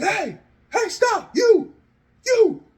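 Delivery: high, strained shout after someone; calling out. Acted. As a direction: yell to stop a fleeing attacker. Jay yelling after fleeing attacker.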